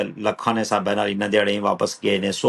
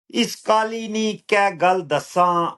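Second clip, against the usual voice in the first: slow, measured verse with drawn-out words; poetry recital; 6 semitones higher, slower than usual. Acted. Poet reciting own lines to an appreciative listener, measured recital. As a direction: recite it higher, easing down at the close.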